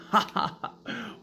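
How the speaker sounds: slow, high, breathy mock laugh. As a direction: laugh right in his face. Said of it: "I'm laughing like".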